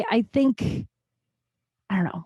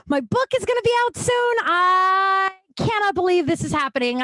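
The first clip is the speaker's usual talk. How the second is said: thrilled; 9 semitones higher than usual. Real, not acted. Thrilled about her book release.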